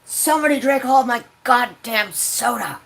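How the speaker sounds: telling auntie the soda is gone; furious complaint. Retold or imitated. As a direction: bark out the accusation. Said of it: "And I'm like".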